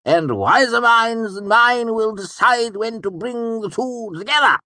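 high excited nobleman; bright, laughing older count; Count Threep voice. A narrator's reading. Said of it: "A sly smile".

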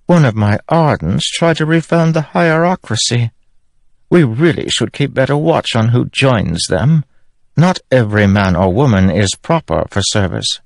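high, cool regal voice; a queen looking down on everyone; high, icy queen dismissing a traitor; haughty queen. Acted. Queen speaking to Kaladin.

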